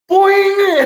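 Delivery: a very high, loud shouted boing sound; shouted cartoon boing. Storyteller marking the mishap, in a very high shouted boing. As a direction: yell it like a cartoon sound effect.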